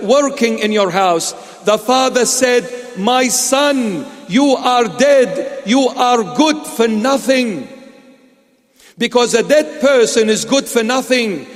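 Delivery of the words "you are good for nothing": normal talking voice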